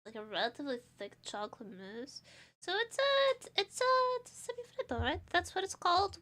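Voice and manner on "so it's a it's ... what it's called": excited. Real, not acted. Excited explaining.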